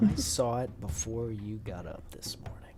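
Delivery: hushed, eerie all-knowing whisper; spooky hushed prophecy, half in jest; mock omniscient whisper.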